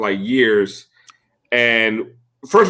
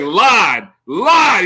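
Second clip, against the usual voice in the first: loud New York shout, "lard" as "lahd"; Russell Simmons; 5 semitones higher, 5 dB louder than usual. Retold or imitated. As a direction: yell "lard" without the R, rising higher on the repeat. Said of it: "He's, like, screaming at me".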